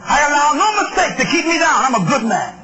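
high-pitched, insistent sermon delivery; impassioned preaching. Acted. Preacher admitting his own mistakes to the congregation, impassioned preaching.

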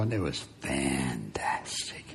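very low, slow near-whisper; hushed awe. Real, not acted. Preacher recalling God's answered prayer, hushed and slow.

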